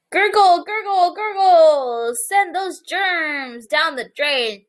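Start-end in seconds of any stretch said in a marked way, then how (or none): 0.1-2.1 high gurgling voice; gurgle sound. Acted. Teacher imitates drain for children, playful gurgle.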